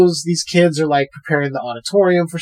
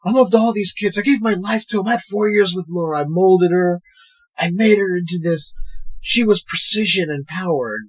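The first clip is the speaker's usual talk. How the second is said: higher-pitched imitated pleading; mock-earnest, insistent defence; impassioned protest; 5 semitones higher than usual. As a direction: act out a coach defending himself. Retells coach's impassioned defence.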